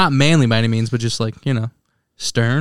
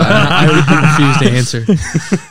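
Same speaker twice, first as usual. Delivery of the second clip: laughing; 4 dB louder, much slower than usual. Real, not acted. Laughing dodge of question.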